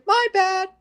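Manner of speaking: high, put-on careless sorry; mocking apology. Mocking how they excuse every dead civilian, high and dismissive. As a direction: toss off the apology in a high sneer.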